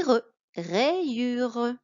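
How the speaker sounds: very slow "Et re, rayure", each part stretched; slow syllables. Shown as a demonstration. Teacher slowly assembling a word for a pupil. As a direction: drag out "rayure" to show its parts. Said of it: "la moitié du Y et le U".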